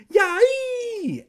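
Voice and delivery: very high, loud yelp; pained scream. A narrator's reading. Evan is jolted by electricity, high screaming. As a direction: scream out as if zapped. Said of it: "Evan let out a scream".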